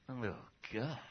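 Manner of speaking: a disgusted sigh God never gives; exasperated sigh. Retold or imitated. God sighing in disgust, quiet and low. What God does not say.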